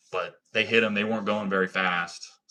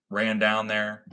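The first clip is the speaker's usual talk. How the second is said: ordinary delivery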